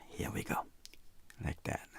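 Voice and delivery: hushed murmur while focused on the brush; quiet concentrated whisper. Real, not acted. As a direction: whisper it to yourself as you work. Painter murmurs while working.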